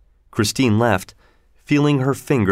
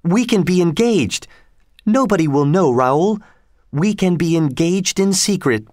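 put-on higher-pitched young heroine's line, eager; lightly voiced heroine making a proposal; a hint of an eager heroine; Christine in earnest; 7 semitones higher than usual. A narrator's reading.

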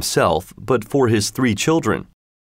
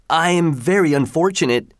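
crying out; 6 semitones higher than usual. A narrator's reading. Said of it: "cried the fisherman".

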